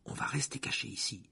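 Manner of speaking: hushed, soft worried voice; shaken. A narrator's reading. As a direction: say it softly, shaken. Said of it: "dit Hermione visiblement bouleversée". (voix basse, bouleversée)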